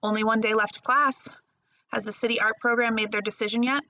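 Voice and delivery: plain reading voice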